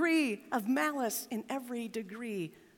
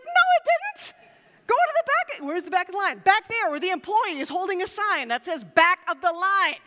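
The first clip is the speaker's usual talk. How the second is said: much higher, louder call at line-cutters; retold shout; 6 semitones higher, 7 dB louder than usual. Pastor retelling yelling at line-cutters, loud and high. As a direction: bark at the couple who cut in. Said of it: "I was like".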